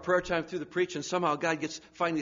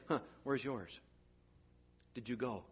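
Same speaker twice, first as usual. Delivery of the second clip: much softer, probing whisper-like question; a quiet inner voice; soft nudge; 4 semitones lower, 9 dB quieter than usual. Retold or imitated. Holy Spirit voiced nudging.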